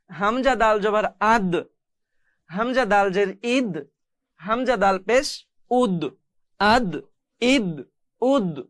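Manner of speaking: final d in "আদ", "ইদ", "উদ" bounced; qalqalah echo. Shown as a demonstration. Teacher drilling students, echoed d ending "আদ, ইদ, উদ". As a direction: release each d of "আদ, ইদ, উদ" with a tiny echo. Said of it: "এইভাবে করে উচ্চারণ করতে হয়".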